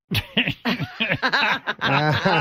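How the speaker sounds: high-pitched burst of laughter; long, high giggling cackle; laughing. Real, not acted. Comic cracks up at his own pumpkin-head punchline, laughing.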